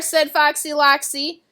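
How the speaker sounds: ordinary delivery, nothing marked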